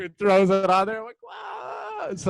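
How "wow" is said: sudden high squeaky wow; mock amazed wow. Friends swap movie stories, a high stunned wow.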